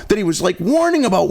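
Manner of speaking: usual speaking voice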